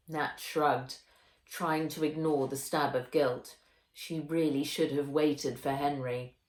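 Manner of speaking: plain reading voice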